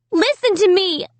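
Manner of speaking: high, frightened shriek. A narrator's reading. Amy shrieking at the dummy, high and scared. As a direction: cry out desperately. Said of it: "I shrieked".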